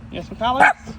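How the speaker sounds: high, perky question to a pet; pet talk. Real, not acted. Offering food to an animal.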